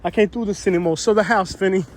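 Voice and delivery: hammed-up fed-up New Yorker; New York accent. Vlogger repeating the lady's rant, laughing at her New York accent. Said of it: "the most stereotypical New York accent".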